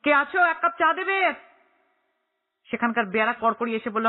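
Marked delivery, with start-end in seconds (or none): 0.0-1.3 high call across the room; a raised, high call for service. A narrator's reading. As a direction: shout for someone to bring tea. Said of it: "চেঁচিয়ে বলল".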